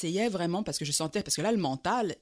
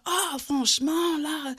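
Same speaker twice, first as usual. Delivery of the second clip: much higher, slow, outraged ah come on; indignant drunk; 9 semitones higher, 4 dB louder, much slower than usual. Retold or imitated. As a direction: play the offended drunk who is being ignored. Drunk man offended at being ignored.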